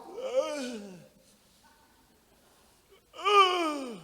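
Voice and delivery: high, whiny impatient groan; husband groaning at his wife's long prayer. Retold or imitated. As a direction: moan at the endless prayer.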